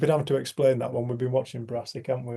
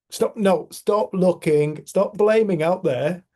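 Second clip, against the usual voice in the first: loud, higher, insistent plea; emphatic command; 5 semitones higher, 8 dB louder, slower than usual. Acted. Mystics telling people to look within, emphatic command. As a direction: urge them forcefully, stretching the words.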